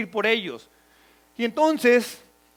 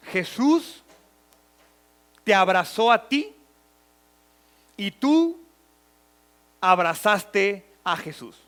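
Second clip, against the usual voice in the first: guided imagining. Acted. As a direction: lead them gently through the scene, phrase by phrase. Leads listeners to imagine scene.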